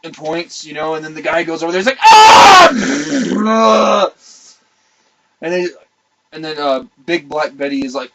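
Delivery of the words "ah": long, loud strained yell; mock agonized holler; comic scream. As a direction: let out a hammed-up bursting howl. Acted cry of straining relief.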